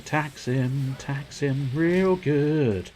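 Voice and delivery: slow, drawn-out tax him; slow drawl. Acted. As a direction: stretch each word out lazily. Drawn-out joke catchphrase.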